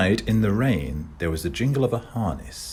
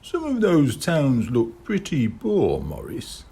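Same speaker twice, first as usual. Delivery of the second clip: doubtful; 7 semitones higher than usual. Acted. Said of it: "said the kid doubtfully".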